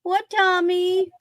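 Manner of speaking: high coaxing call to the cat; calling a pet. Real, not acted. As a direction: coax the cat to come.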